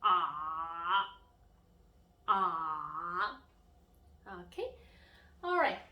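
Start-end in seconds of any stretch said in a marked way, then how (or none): 0.0-3.4 long "Ah" dipping deep then rising; low third tone. Shown as a demonstration. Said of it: "go low and go deep".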